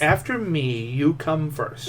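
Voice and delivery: drawn-out soft quoting of the saying; slow quoted saying. Retold or imitated. Host repeats the saying slowly and softly. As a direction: say it slowly and quietly, like a motto. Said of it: "Someone told me that once".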